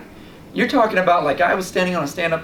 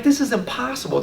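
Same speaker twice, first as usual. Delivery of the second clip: high, amazed this is impossible; giddy at so many fish; laughing disbelief; 7 semitones higher than usual. Retold or imitated. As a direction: marvel at it half-laughing. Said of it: "I started laughing. I'm like".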